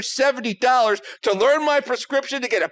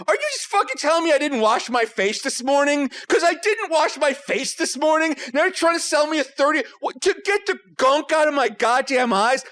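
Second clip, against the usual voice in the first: high-pitched, incredulous comic rant; outraged; 5 semitones higher than usual. Retold or imitated. Patient being sold cream for unwashed eyes, mock outraged. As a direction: retell it as an indignant outburst, pitched up. Retold outburst at the eye doctor.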